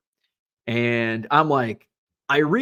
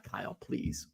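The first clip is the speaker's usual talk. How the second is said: whispered, hushed begging; pleading whisper; 5 semitones lower, 15 dB quieter than usual. Retold or imitated. Retelling begging the auctioneer friend, whispered plea. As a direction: beg him under your breath. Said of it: "so I'm, like, begging".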